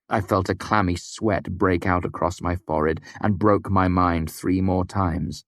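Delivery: usual speaking voice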